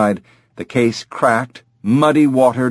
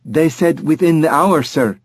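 put-on higher-pitched, polite young rep; deferential courier; 7 semitones higher than usual. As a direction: answer the boss politely, pitched up. Courier answering impatient customer.

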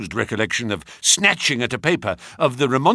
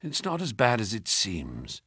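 weak mutter; 2 semitones lower, 8 dB quieter than usual. Acted. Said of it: "he muttered in a very weak voice".

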